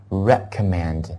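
"recommend" said slowly with "rec" set apart; careful, spaced "recommend" for repeating; pronunciation demo. Shown as a demonstration. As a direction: model "recommend" syllable by syllable. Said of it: "跟我读一下".

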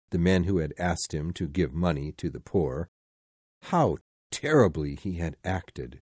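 ordinary delivery

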